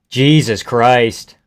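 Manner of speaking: ordinary talk, nothing marked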